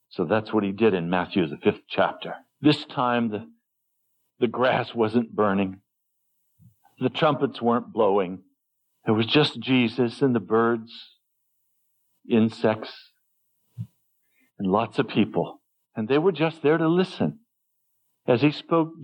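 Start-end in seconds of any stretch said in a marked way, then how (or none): none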